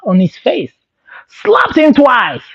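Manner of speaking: usual speaking voice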